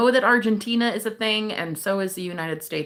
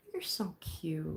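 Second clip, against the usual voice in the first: whisper; 3 semitones lower, 13 dB quieter, slower than usual. Real, not acted. Compliments a viewer softly.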